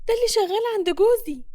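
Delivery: high-pitched stunned realisation; shocked. A narrator's reading. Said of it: "أول ما بتشوفها بتتصدم وبتقول". (بصدمة وبصوت رفيع)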